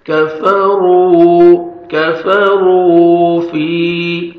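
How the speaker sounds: کفروا with a full heavy ra, long waw. Shown as a demonstration. Teacher demonstrating the thick ra in کفروا. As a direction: stretch the waw in کفروا one second, thick ra. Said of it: "را کو موٹا بھی کرنا ہے".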